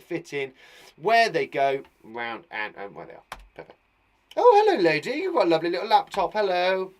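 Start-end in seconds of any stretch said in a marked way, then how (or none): 4.4-6.9 high, loud cartoonish hello; put-on greeting voice. Chatting to a lady about her laptop, put-on high voice.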